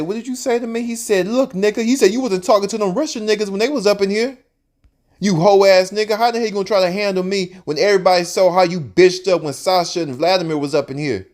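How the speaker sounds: high, heated cussing out; angry tirade. Retold or imitated. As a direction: tear into the supervisor. Jacob cusses out supervisor.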